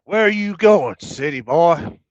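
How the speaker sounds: put-on Southern accent. Local challenging a city boy, mock Southern accent. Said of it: "We'll figure out an accent eventually".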